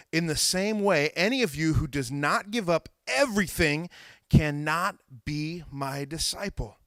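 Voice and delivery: measured, emphatic Bible reading; scripture reading. A narrator's reading. Preacher reading the king's parable, measured and emphatic. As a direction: read it out deliberately, weighting key words.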